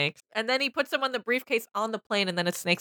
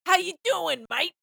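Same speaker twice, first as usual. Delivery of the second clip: mock Cockney; 8 semitones higher than usual. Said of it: "They sound like fucking Cockney".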